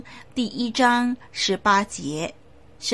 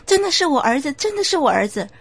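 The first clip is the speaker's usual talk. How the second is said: higher, urgent insisting; frantic mother; 6 semitones higher, 4 dB louder, faster than usual. Acted. The mother insists to the pastor, higher and urgent. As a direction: she begs the pastor to believe her.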